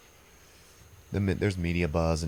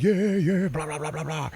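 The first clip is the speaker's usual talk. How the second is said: much higher hammed-up metal band voice; mock metal singer; 9 semitones higher than usual. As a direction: ham it up like a death metal frontman. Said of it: "and they're like".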